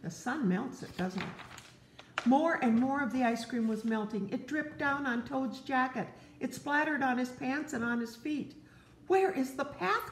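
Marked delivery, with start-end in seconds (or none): 2.2-8.6 gentle read-aloud to a little one; storybook narration. Acted. Parent reading Frog and Toad to a child, gently. 9.1-10.1 much higher, worried wail; lost, panicky cry. Acted. Parent voicing blinded Toad crying out, much higher. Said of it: "cried Toad".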